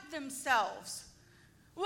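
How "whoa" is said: long hammed-up cry; drawn-out imitation moan; mock wail. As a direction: wail like a worshipper cutting himself. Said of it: "groaning and moaning".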